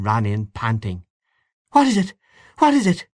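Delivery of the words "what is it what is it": high, louder frantic calling out; alarmed cry. Acted. Mother running in to the crying child, a high alarmed cry. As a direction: burst in asking in alarm. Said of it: "she cried".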